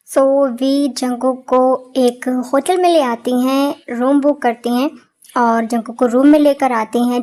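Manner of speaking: calm narrator recounting the scene; story narration. A narrator's reading. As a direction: narrate it low and unhurried.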